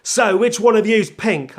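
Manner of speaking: loud, high, drawn-out fake American; badly faked American record exec; failed American accent. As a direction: push a hopeless American accent hard. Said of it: "I can't do an American accent".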